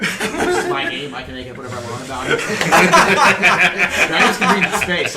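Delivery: loud, high laughing protest. Real, not acted. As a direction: defend your game through laughter. GM laughing defending his rules.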